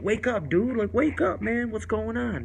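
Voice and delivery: high, loud pleading to wake up; shaking a passed-out friend awake, high and loud; urgent wake-up call. Retold or imitated. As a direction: shout him awake, alarmed.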